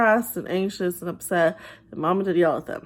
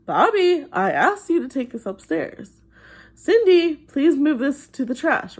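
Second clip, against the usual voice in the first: mildly exasperated parent repeating a request; a hint of a parent losing patience; mock frustrated mom, higher and pressed; 6 semitones higher, 3 dB louder than usual. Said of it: "then the parent gets frustrated".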